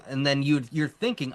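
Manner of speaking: ordinary talk, nothing marked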